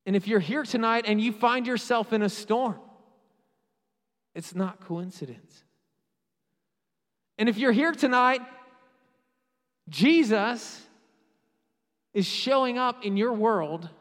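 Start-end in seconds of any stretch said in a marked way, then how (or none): 0.0-2.8 raised, louder sermon voice; emphatic preaching. Real, not acted. Preaches at full force. 7.4-13.9 loud, raised, with big pauses; emphatic preaching. Real, not acted. Preaches at full force.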